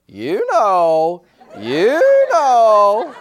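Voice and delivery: high, loud squawked "you know, you know"; laughing parrot imitation; parrot mimicry. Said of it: "that bird looks at him and goes".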